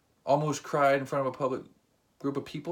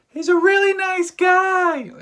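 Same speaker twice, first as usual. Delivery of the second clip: way higher, louder and slow, a squeaky put-on woman; high mock female voice; 11 dB louder, slower than usual. Ex vouching for him to the comedian, shrill high mock voice. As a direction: squeal it like a gushing girl. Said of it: "she's like".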